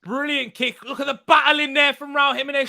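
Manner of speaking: high, fast play-by-play call; excited commentary. Real, not acted. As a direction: call the replay like live TV. Replaying goal like a commentator.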